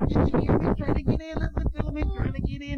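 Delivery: loud, high, frantic whispered alarm; panicked piggies. Acted. Father reading to his child, voicing the panicked piggies. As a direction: sound like frightened piglets.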